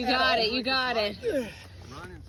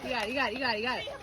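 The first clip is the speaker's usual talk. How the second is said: usual speaking voice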